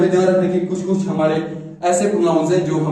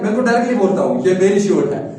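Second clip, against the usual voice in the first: ordinary talk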